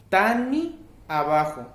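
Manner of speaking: "Tani" lento y marcado, más fuerte; modelo de pronunciación. Shown as a demonstration. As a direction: presenta "tani" con calma a los alumnos. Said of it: "es tani". ("tani" despacio, sílabas claras)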